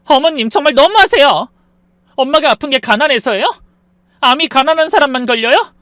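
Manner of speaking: mild acted outburst; exploding anger. Daughter-in-law finally snapping at mother-in-law, mild acted anger. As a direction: finally snap back at her. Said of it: "참고 있던 게 폭발했고".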